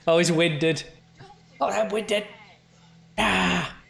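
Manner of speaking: ordinary talk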